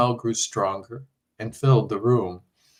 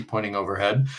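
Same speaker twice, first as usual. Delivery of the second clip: ordinary delivery, nothing marked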